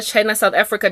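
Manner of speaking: normal talking voice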